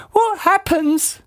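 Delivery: put-on squeaky puzzled old lady; Missus Twit voice. A narrator's reading. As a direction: play her as a high, befuddled old wife.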